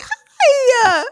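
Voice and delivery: put-on high squeaky baby greeting; baby talk. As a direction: coo hi to a baby. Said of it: "I do that".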